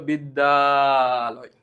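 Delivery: slow, stretched বিদ্যালয় with long pulled vowels; drawn-out mock reading. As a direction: pull বিদ্যালয় out like chanting. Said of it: "বড় করে টান দেয়". (বিদ্যালয় টেনে টেনে সুর করে বলা)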